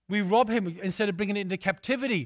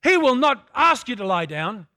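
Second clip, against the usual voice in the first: usual speaking voice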